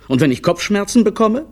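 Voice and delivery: high, whiny little clown; fretful clown. A narrator's reading. Clown husband frets to his wife, high and whiny.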